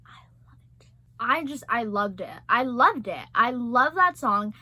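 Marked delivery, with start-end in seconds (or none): 0.1-0.9 whisper. Real, not acted. Whispering fondly about the song.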